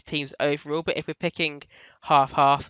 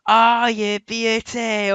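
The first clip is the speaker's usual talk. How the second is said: put-on slow Scottish commentator accent, raised; Andy Gray Scottish accent; 5 semitones higher, 4 dB louder, much slower than usual. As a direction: imitate a Scottish football commentator. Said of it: "forgive my Scottish accent".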